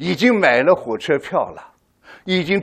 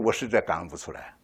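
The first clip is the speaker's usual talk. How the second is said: quiet plea; 10 semitones lower, 10 dB quieter than usual. Retold or imitated. Kneeling man confessing to God, soft and low. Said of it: "你们能不能同情我".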